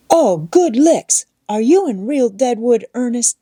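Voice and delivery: Tom Sawyer's voice. Acted. Tom coaxing Huck to stay, eager boyish voice. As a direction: pitch up into a keen young boy.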